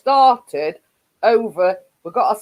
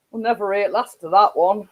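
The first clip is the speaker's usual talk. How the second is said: ordinary talk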